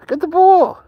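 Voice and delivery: high, loud, excited call to get the ball; excited pitch-up urging. Real, not acted. Owner urging his dog to chase the ball, high and excited. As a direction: cheer the dog on to grab it.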